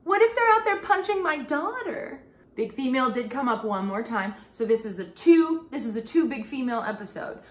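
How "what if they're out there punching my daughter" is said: much higher, louder protective wail; a pretend worried shark mom; hammed-up high-pitched alarm; mock panic. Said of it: "I'm like".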